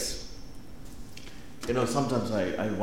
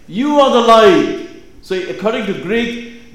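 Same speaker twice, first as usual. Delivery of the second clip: loud, higher-pitched preaching at full force; 7 semitones higher, 9 dB louder than usual. Acted. Preacher declaring God's dream for you, impassioned preaching. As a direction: proclaim it to the congregation with rising fervour.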